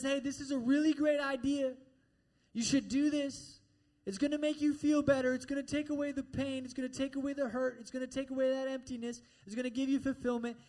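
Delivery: high-pitched, insistent tempter, lightly voiced; the devil. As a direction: push each promise eagerly, pitched high. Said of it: "the devil whispers in our ears and says".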